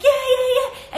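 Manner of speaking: high, loud burst of cheering; imitated cheering. Host as teammates celebrating the save, excited cheer. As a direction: whoop along like excited teammates. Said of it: "Everybody else is like".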